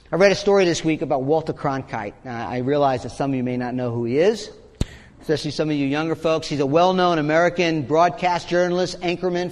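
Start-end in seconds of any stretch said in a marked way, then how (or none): none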